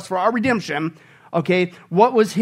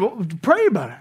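ordinary talk

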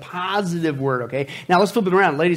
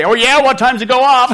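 louder, higher mocking jab; sarcastic quip; 6 semitones higher, 8 dB louder than usual. Husband teasing wife about curlers, louder mock sarcasm. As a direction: toss it off as a snide joke. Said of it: "tempted to say".

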